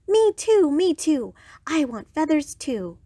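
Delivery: high, eager "me too" clamour; excited cry. A narrator's reading. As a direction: pipe up high, wanting it badly. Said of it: "cried Iguana".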